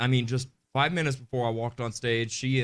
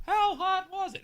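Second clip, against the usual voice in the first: high hammed-up question; playful high-voiced "How hot was it?"; mock high setup. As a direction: squeak the joke setup way up high.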